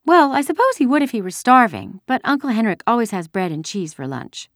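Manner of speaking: put-on high-pitched childish prattle; chattering little girl. A narrator's reading. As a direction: keep up a silly girl's chatter. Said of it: "Keep chattering".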